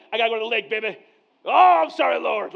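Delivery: loud, high hammed-up sorry; mock repentant. Pastor joking to congregation about his many sins, hammed-up loud apology. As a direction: overplay the guilty sinner.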